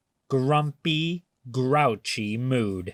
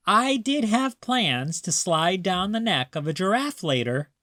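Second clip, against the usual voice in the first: higher, teasing grandma voice; Grandma's playful voice; 6 semitones higher than usual. A narrator's reading. Grandma coaxing grumpy Maya, high and teasing. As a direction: sell the silly idea like a sly grandma.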